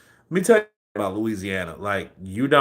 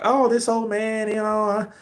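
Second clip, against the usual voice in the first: much higher, mocking squeaky coworker voice; imitating Melissa; 10 semitones higher than usual. Coworker mocked for not knowing a famous customer, high mocking voice. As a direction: mimic her high-pitched annoying voice. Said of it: "she had this high-pitched voice".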